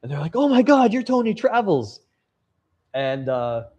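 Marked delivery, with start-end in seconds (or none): 0.4-2.0 starstruck excitement. Retold or imitated. Fans at the campfire suddenly recognizing the YouTuber, starstruck. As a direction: squeal it like meeting a celebrity.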